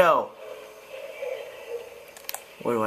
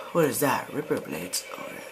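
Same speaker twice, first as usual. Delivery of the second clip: ordinary delivery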